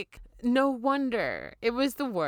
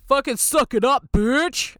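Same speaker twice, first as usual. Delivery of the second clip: louder, higher, blunt brush-off; mock doctor dismissal; 4 semitones higher, 7 dB louder, slower than usual. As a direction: bark it like a doctor who doesn't care. Doctor's dismissive advice, acted out.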